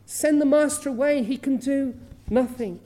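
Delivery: loud, harsh announcement of no hope; loud, high, hopeless declaration; blunt cruel news. Retold or imitated. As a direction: bark it out like cold messengers. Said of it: "they say".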